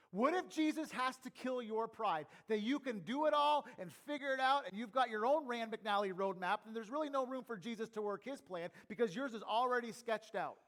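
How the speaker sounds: emphatic sermon voice, pushed out loud; full-force preaching. Real, not acted. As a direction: press the challenge hard on the congregation.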